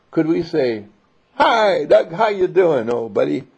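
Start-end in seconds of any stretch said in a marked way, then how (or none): 1.3-3.5 high, loud, overly cheerful hello; cheery greeting. Acted. Greeting Doug as he walks in, acting breezy and cheerful. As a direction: greet him like nothing happened, bright and loud.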